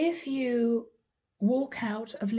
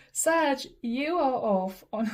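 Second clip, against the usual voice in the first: laughing; 3 semitones higher, slower than usual. Real, not acted. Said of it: "I'm sorry, I'm laughing".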